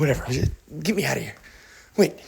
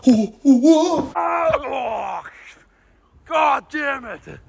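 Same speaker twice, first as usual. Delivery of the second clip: panicked cries; 8 semitones higher, much slower than usual. Acted. Traveller crying out in panic as time travel lurches. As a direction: cry out as things go wrong.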